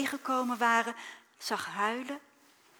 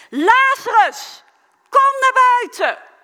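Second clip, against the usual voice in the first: full-voiced shout, much higher, slowed; loud call; 13 semitones higher, 17 dB louder, slower than usual. Jesus calls Lazarus from the grave, ringing shout. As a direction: call the dead out of the tomb. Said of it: "riep hij met luide stem".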